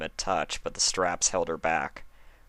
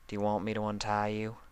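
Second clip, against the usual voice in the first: gentle question; 3 semitones higher, 5 dB quieter, faster than usual. Acted. Applejack asks gently.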